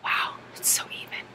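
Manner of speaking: hushed whisper; soft whispered aside. Real, not acted. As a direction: breathe it out as a secret.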